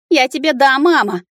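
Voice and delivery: high, loud scolding; indignant squeal. A narrator's reading. Fairy slapping the dragon's paw away, squealing indignantly. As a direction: snap back high and outraged. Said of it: "Взвизгнув, я стукнула его".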